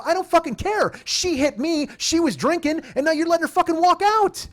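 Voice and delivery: very high, outraged protest; retold yelling. Protesting to the cop that she walks free, yelling. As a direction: pile up grievances in high indignation. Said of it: "if you're gonna keep yelling at her".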